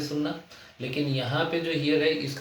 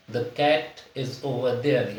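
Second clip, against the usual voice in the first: slow example reading; much slower than usual. Shown as a demonstration. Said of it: "teacher reads example sentence".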